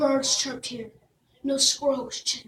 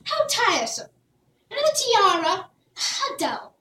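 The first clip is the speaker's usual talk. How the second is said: higher, bored and petulant; spoiled princess; 6 semitones higher than usual. Acted. Princess to her subjects, high and petulant. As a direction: sigh over every gift.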